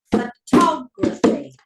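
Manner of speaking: pho-TOG-raphy, slow and loud, TOG punched; stress on second syllable. Shown as a demonstration. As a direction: beat out photography with weight on TOG. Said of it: "see where the stress changes".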